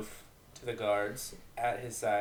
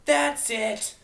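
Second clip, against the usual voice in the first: furious outburst; 12 semitones higher, 8 dB louder than usual. Acted. Fight breaks out.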